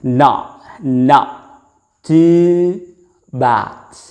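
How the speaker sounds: "Not, not too bad" spaced out, "too" lifted; model for repetition. Shown as a demonstration. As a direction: say "Not too bad" slowly for students to copy. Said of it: "repeat after me". (ucapkan "Not too bad" pelan untuk ditirukan)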